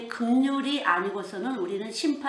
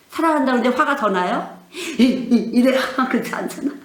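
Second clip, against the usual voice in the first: loud, higher, breaking into laughter; laughing disbelief; 3 semitones higher, 11 dB louder than usual. Real, not acted. Preacher teases listeners about being told "I love you", laughing loudly. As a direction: laugh through a teasing rhetorical question.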